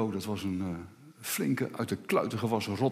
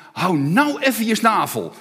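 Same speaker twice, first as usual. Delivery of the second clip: louder, irritated hush-up; fed-up snap; 8 semitones higher, 8 dB louder than usual. Retold or imitated. Repairman, fed up, snaps at the parrot. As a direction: tell the bird to shut up, annoyed. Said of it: "tegen die papegaai zegt".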